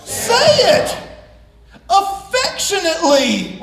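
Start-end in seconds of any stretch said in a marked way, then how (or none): 0.0-1.0 high, loud prompt to the crowd; calling out. Real, not acted. Preacher urging the congregation to say it aloud. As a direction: get the room to answer. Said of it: "calling congregation to respond".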